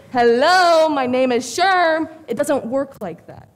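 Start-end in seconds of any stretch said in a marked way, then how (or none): 0.1-2.1 loud, drawn-out mock heroic hello; mock dream-man greeting. Woman mocking her boyfriend as a dream hero, mock-grand greeting. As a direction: announce it like a mock knight off his horse. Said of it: "get off a horse and say".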